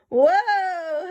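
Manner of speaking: high, amazed whoa; bunny knocked back in wonder; startled whoa. Acted. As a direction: react to a whirling machine. Hoppy startled by boing.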